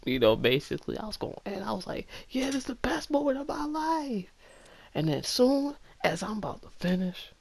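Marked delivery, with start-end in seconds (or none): 2.3-4.3 ecstatic self-quote. Retold or imitated. Self-quote in ecstasy, high and far.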